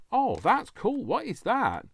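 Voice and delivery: delighted. Real, not acted. Unboxing, delighted find.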